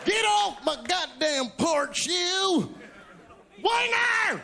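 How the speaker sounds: put-on high yelling old man off the porch; shouting at trespasser. Shown as a demonstration. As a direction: bark it at a trespasser. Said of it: "use it in a sentence for me".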